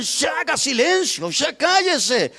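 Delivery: high, scolding demand for silence; hushing. Acted. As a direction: shush him, stretching the last word. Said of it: "Lo callaban y le decían".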